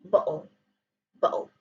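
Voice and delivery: Cockney "bottle" as "bo'ul"; Cockney glottal stop. Shown as a demonstration. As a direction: catch the throat where the t was, Cockney style. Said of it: "the Cockney language in London".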